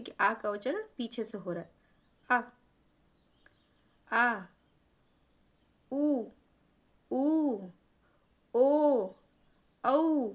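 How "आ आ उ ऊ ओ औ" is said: आ उ ऊ ओ औ said one by one, rising in level; teacher running a vowel drill; back vowel drill. Shown as a demonstration. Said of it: "तो ये पीछे हिस्से से".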